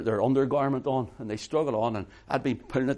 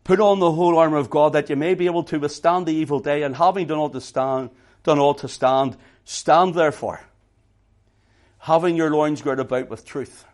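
loud, ringing scripture reading; preaching scripture; 2 semitones higher, 8 dB louder than usual. Acted. Preacher reading Ephesians to the congregation, loud and ringing. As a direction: proclaim it to the whole congregation. Reads scripture at full force.

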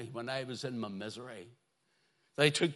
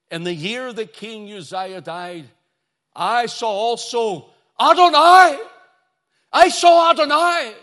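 emphatic, excited reading; 8 semitones higher, 16 dB louder than usual. Shown as a demonstration. Preacher reads Isaiah seeing the Lord, emphatic and exultant. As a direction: proclaim it with rising awe, ringing out on Adonai. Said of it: "That's the way I would read it".

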